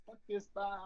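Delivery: mock a backstage tantrum; pissed off. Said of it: "I'm pissed".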